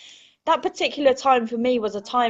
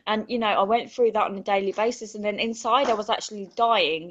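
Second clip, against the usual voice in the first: ordinary delivery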